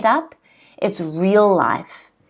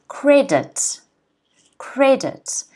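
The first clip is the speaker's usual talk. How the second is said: Credit with the first syllable stressed; 5 semitones higher, slower than usual. Shown as a demonstration. Teacher modelling Credit for the class, first syllable stressed. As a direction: hit CRE in Credit, let dit fall away. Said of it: "the first syllable is stressed".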